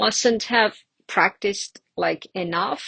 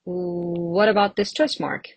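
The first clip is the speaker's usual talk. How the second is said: ordinary talk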